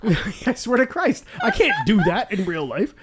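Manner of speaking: high, loud, through laughter; talking through real helpless laughter; laughing hard. Real, not acted. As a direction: crack up while trying to get it out. Cracking up at own joke.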